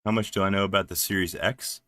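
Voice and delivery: ordinary talk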